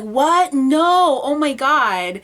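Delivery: high, loud gasp of disbelief; shocked disbelief. Retold or imitated. Viewer shocked at Tammy Rose's reveal, loud disbelief. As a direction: react like you just saw a friend's head. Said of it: "it's just like".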